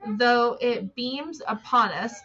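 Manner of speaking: slow, emphatic reading aloud; deliberate quote reading. Acted. Host correcting a misread quote for viewers, deliberate quote reading. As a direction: read it out firmly, word by word.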